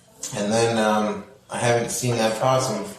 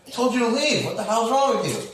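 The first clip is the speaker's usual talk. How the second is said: mock exasperated yell; 9 semitones higher than usual. Yelling at possum again.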